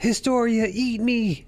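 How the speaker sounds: high mock begging, eat me; a hammed-up high plea. Eren pleading with Historia to eat him, in a hammed-up high voice. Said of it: "he's like".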